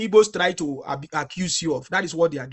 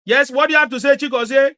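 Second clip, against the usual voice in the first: repeated louder, high calling question; to someone hard of hearing, louder; 6 semitones higher, 7 dB louder than usual. Real, not acted. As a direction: call it again, much louder, to reach them. Repeats question, no answer yet.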